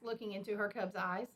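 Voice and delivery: ordinary delivery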